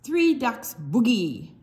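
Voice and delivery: usual speaking voice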